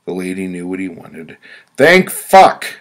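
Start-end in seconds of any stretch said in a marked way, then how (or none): 1.8-2.7 high, loud cry of relief; relieved yell. Retold or imitated. Relieved when the clerk finally gets it, loud high yell. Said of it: "I yelled".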